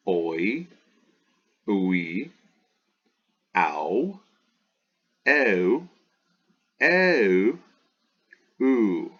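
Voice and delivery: "I, a, oi, ui, ow, oo" recited slowly, a pause after each; diphthong drill. Shown as a demonstration. Teacher repeats the diphthongs for Greek students, deliberate drill. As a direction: sound out each diphthong as one glide. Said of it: "Let me go through that one more time".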